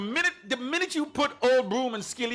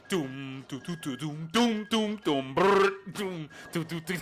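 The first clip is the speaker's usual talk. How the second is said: beatboxing; 5 semitones lower, slower than usual. Retold or imitated. Imitating the rhythm beat.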